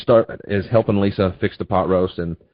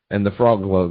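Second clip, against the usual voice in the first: ordinary talk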